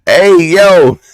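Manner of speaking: high, loud, aggressive yell; mock uppercase New York holler; shouty New York dude. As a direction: bark it like an aggressive Brooklyn guy. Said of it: "as soon as he type in uppercase".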